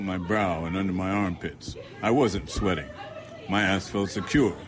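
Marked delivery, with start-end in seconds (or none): none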